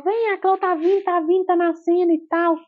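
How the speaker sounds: high, eager coaxing; doctor cheering as the baby arrives, high; excited encouragement. Retold or imitated. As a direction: urge her on, the baby is coming. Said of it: "Aí ela falou".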